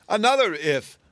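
high, loud gotcha; triumphant cry. A narrator's reading. Woman catching the wizard out, a high loud cry. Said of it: "cried the woman".

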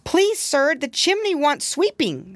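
a frightened kitten begging politely; pleading kitten voice. Acted. Poor Tom Kitten pleads.

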